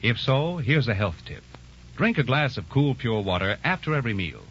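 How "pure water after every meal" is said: ordinary talk, nothing marked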